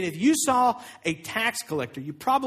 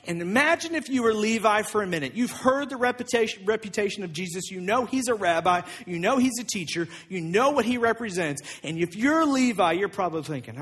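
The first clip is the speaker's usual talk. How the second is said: usual speaking voice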